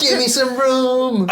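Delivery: high, loud and slow crowd cry; mosh pit call. Retold or imitated. Guest imitating dubstep crowd at the stage front, loud high cry. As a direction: shout it like a raver in the pit. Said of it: "is that what they used to say".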